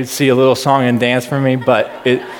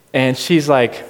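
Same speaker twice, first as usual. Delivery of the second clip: usual speaking voice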